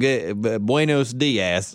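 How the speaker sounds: slow, low southern-drawl Spanish. Pretend. Impersonating a southern politician greeting in Spanish, slow low. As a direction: stumble into Spanish like a Texan. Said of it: "that southern person".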